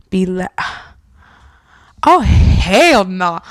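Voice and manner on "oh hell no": loud, drawn-out oh hell no; outraged hell no. Real, not acted. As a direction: let the disbelief burst out. Outraged at rude sister-in-law.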